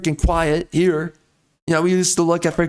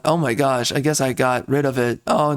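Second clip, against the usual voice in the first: normal talking voice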